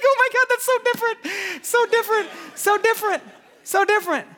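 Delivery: very high, loud squeal of wonder; mock amazement. Parent reacts to the school's technique, hammed-up amazement.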